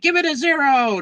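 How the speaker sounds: squeaky high mock voice of a fan; mock fan demand. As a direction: sneer it in a silly high voice. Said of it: "some people are like".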